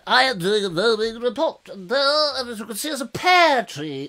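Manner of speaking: mock field report in a put-on voice; rival video reporter. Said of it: "there's another one coming in".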